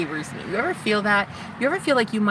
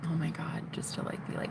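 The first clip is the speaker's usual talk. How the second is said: breathless whisper; 13 dB quieter than usual. Real, not acted. The man she likes is close, whispering to camera. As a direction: hush it so he won't hear. Excited about the man nearby.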